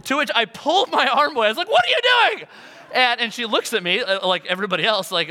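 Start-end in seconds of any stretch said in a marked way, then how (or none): 1.7-2.5 high, loud startled yell; alarmed outburst. Retold or imitated. Pastor retelling his reaction to the stamp lady, alarmed yell. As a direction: recoil and shout in protest.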